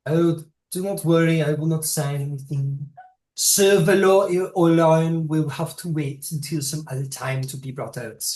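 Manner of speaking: put-on high, loud Siouan accent. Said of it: "trying on my Siouan accent".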